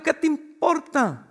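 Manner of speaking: high, drawn-out comeback; mock retort. Acted retort to the nosy question. (réplica fingida, aguda y alargada)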